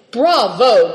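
delighted cry. A narrator's reading. Marin applauds his guest's good manners, crying out loudly. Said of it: "cried the Marin".

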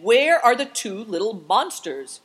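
loud, high angry question; shouted demand. A narrator's reading. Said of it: "shouted the man".

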